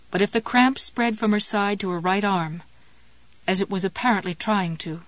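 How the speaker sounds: ordinary delivery, nothing marked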